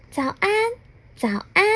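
a wee voice calling from the flowerbed; tiny fairy voice. Acted. Little fairy greets Mr. Rude, tiny and soft. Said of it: "很细很小的声音".

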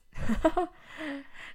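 breathy whispered laugh. Real, not acted. Laughing after mimicking mom.

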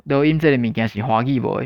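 ordinary delivery

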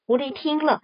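usual speaking voice

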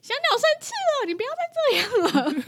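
mother scolding her roaring son, mock panic; pleading with son. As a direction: beg the little boy to stop, almost squeaking. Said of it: "对着天空一直狂吼".

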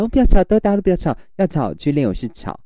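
high, loud pretend scolding; high mock shout. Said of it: "说".

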